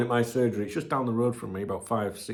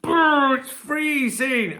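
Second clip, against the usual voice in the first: high, slow shivery brr; chattering at the cold morning air; shivering cold; 14 semitones higher, 4 dB louder, much slower than usual. Real, not acted. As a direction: shiver the words out slowly. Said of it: "I'm bloody cold, me".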